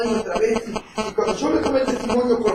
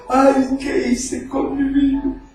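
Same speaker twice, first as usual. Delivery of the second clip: retold disconsolate crying; 3 semitones higher, much slower than usual. Broken man confessing to the preacher, imitated disconsolate lament. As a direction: wail it mildly, imitating someone weeping over their life. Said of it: "lloraba desconsoladamente diciendo".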